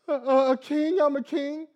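a hint of a sniffling three-year-old; imitating son. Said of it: "he's still crying, whimpering".

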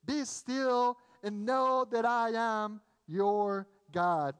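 verse drawn out slowly, weight on each word; slow proclamation. Acted. Preacher declaring the verse to the congregation, slow and emphatic.

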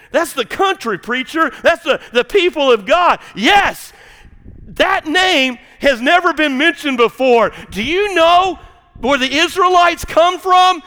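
loud high shouting sermon climax; full-force preaching. Acted. Preacher rousing his congregation, loud high fervent shouting. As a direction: preach it to the rafters.

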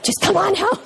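hammed-up tired husband on the phone; exasperated tone. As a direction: imitate his fed-up come-home tone. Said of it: "he had that tone of voice".

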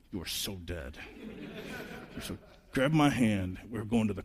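dad to grinning son, hushed mock threat; quiet threat. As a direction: mutter it like a promise. Dad to son who hid.

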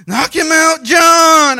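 high excited shout, cheering on a boxer; kids' fight-fan yell. Retold or imitated. Boys cheering a boxer from the back seat, shouting. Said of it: "they go".

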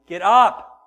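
loud yelled wake-up call; screaming at teenager. Retold or imitated. Parent shouting a teenager out of bed, loud yell. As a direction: yell it like waking a late teen. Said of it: "screaming at them".

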